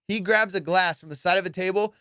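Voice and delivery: ordinary delivery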